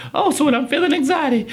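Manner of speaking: high-pitched acted-out realization; dawning realization. Guest realizing what therapy tools are for, enlightened. Voices his own realization.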